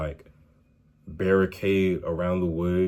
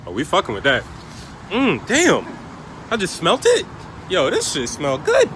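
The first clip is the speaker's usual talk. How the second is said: excited; 12 semitones higher, 8 dB louder than usual. Real, not acted. Smelling food at gas station.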